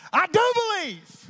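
high, loud cry of belief; exclaimed. Acted. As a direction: burst out with the confession. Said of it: "the boy's father exclaimed".

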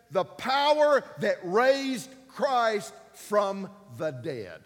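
slow, loud, high scripture line; preaching. Acted. Pastor quoting scripture to church, slow and loud. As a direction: stretch the verse out and boom it.

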